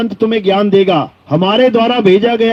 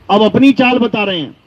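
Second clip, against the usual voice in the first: normal talking voice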